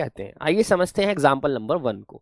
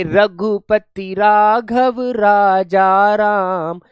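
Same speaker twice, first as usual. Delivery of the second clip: very slow and louder, every syllable drawn out; slow demonstration; 4 semitones higher, 6 dB louder, much slower than usual. Shown as a demonstration. Teacher reading the example line slowly for the class. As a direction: spell out Raghupati Raghav Raja राम slowly so the रा sounds stand out. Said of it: "रे बार बार जो है ये repeat हुआ है".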